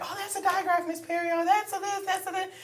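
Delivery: put-on high, eager child voice; imitating kids. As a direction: chirp it like an excited student. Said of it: "they're like".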